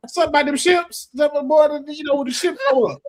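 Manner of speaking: high, halting stammer, words tripping; stuttering uncle. Retold or imitated. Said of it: "dad sound just like that 'cause he stutters".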